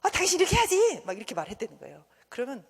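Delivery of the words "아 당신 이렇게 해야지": high, loud nagging at a spouse; acted exasperated telling-off; irritated scolding. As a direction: scold your partner, voice raised high. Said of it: "막 짜증을 내".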